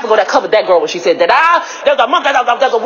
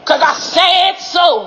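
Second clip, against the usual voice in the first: high, drawn-out declaration; yelling; 4 semitones higher, much slower than usual. Real, not acted. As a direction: yell it slowly, every word stretched. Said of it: "me yelling".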